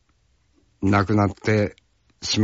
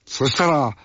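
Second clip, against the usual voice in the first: ordinary talk, nothing marked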